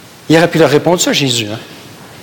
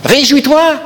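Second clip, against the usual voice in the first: exhortation plus forte; 10 semitones higher, 4 dB louder, slower than usual. Acted. Repeated, louder exhortation.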